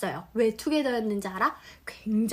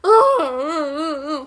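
mock crying; 3 semitones higher, 7 dB louder than usual. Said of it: "속상하죠".

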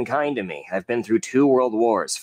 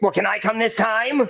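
high, whiny plea; pleading; 9 semitones higher than usual. Acted. Cody begs his friends to take him along, high and pleading. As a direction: beg to be let along. Begging to join the trip.

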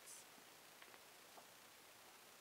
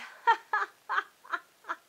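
loud, high cackling roar; roaring witch laughter; 13 semitones higher, 14 dB louder than usual. A narrator's reading. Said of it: "the witches roared even louder".

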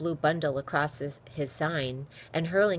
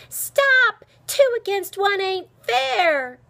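high, loud, stretched-out scream; loud indignant shriek, drawing out the words; screamed protest; 9 dB louder, slower than usual. A narrator's reading. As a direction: scream in outrage at being ganged up on. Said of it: "screamed Dicey".

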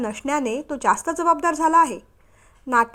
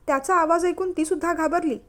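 plain reading voice

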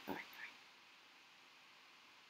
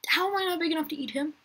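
indignant disbelief; 10 semitones higher, faster than usual. Real, not acted. Outraged at failing to eat.

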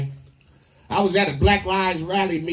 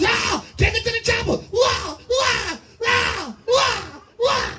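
mock moaning woman; slower than usual. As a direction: overdo her excited cries, very high. Woman in bed, voiced loudly.

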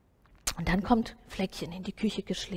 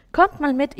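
calling out; 6 semitones higher, 10 dB louder than usual. A narrator's reading. Grandma calls the kids along.

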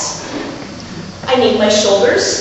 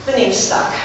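normal talking voice

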